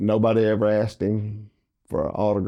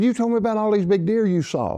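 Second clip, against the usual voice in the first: mock accusing, much higher; 12 semitones higher than usual. [name] accusing Parker over the deer, high mock accusation. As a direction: throw the accusation back at him.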